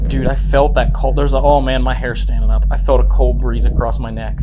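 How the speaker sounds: spooked and excited. Real, not acted. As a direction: blurt it out, shaken. Spooked by cold breeze.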